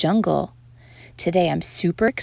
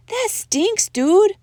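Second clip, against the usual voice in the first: high doggy outcry, louder at the end; exclaiming; 12 semitones higher, 4 dB louder, slower than usual. A narrator's reading. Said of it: "exclaimed all the doggies".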